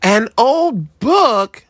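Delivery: disappointed. A narrator's reading. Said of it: "with disappointment".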